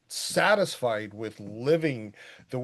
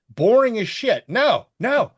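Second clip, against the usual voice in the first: loud, higher burst of dismissal; emphatic outburst; 9 semitones higher, 7 dB louder than usual. Real, not acted. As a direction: blurt it out, loud and high. Punchline after mock film-snob talk.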